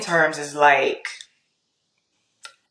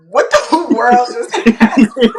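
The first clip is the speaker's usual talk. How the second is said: higher, louder mock bewilderment; baffled husband, laughing; 5 semitones higher, 6 dB louder, slower than usual. Guest imagines her husband at the table, laughing and bewildered. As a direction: act stunned while breaking up laughing.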